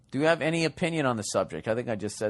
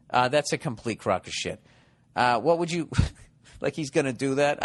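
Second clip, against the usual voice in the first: usual speaking voice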